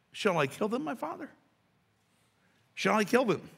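high-pitched, eager pleading question; eager king. Acted. King asks Elisha about the captives, eager. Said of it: "Sound like he wanted to".

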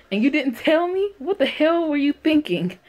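high-pitched indignant questioning; angry confrontation. Acted. Reads Liam's angry outburst.

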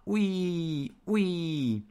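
high, drawn-out wind wail; whistling wind howl. A narrator's reading. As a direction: howl like a draught through a crack. Narrator imitates the whistling wind.

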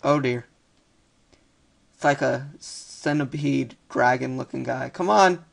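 ordinary delivery

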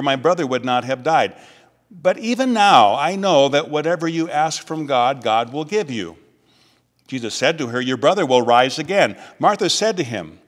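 measured, solemn gospel reading; scripture reading. Acted. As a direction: read the passage to the congregation with care. Reads the gospel aloud.

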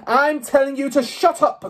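high, loud furious roar. A narrator's reading. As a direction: roar her into silence. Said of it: "the Trunchbull roared".

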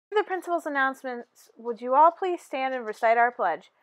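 plain reading voice

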